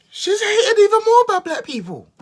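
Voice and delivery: very high, loud squealed outburst; exaggerated shriek. Real, not acted. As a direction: shriek it out in comic disbelief. Exclaims in exaggerated disbelief.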